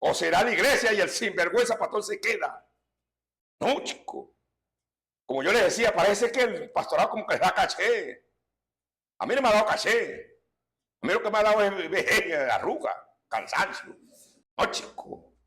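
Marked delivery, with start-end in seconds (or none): 3.6-4.3 whispered aside. Real, not acted. Aside to himself. 14.6-15.3 whispered aside. Real, not acted. Aside to himself.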